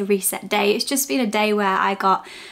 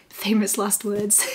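slow, laughing through the words; laughing at herself; slower than usual. Real, not acted. Laughing at her own promise.

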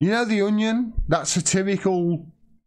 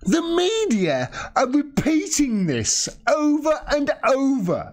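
exasperated ranting; 9 semitones higher than usual. Real, not acted. Host telling viewers the media keep repeating this, exasperated. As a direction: drive each word home, pitched high.

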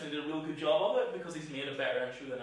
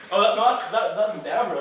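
laughing; 6 semitones higher, 12 dB louder than usual. Real, not acted. Laughing about the zebra pattern.

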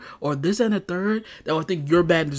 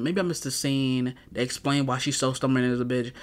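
usual speaking voice

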